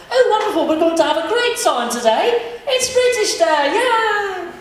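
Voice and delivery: very high, loud, jolly British accent; happy British. Shown as a demonstration. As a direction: gush like an overexcited British announcer. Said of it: "British and they want happy and fun".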